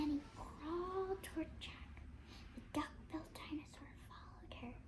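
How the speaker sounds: soft, unvoiced storytelling in suspense; whispered narration. A narrator's reading. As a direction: hush the tale as if the dinosaur listens.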